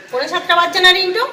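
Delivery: পৌনে সাতটা asked high, loud and fast; loud demanding question. Real, not acted. Mother demands Rintu confirm the late hour, loud and sharp. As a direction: fire পৌনে সাতটা at him as a challenge.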